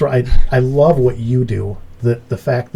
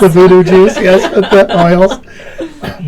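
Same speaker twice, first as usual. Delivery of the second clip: laughing; 7 semitones higher, 8 dB louder, much slower than usual. Real, not acted. Laughing about voodoo juice.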